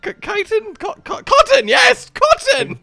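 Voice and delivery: stammering high impostor; very high, halting character voice; weird put-on voice. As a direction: grope for the name in a squeaky voice, then seize on it. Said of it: "putting on a weird voice".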